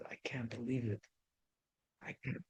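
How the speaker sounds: soft broken whisper of disbelief; a hushed, tearful whisper; mild crying. Retold or imitated. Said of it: "He cried".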